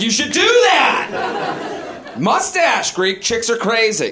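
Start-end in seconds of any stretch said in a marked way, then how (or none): 0.0-2.1 high, drawn-out egging-on yell; mock eager urging. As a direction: cheer a buddy into a bad idea, slowly. Acts out egging a buddy on.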